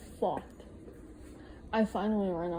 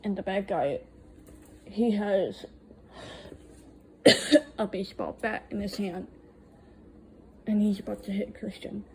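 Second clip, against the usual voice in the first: ordinary delivery, nothing marked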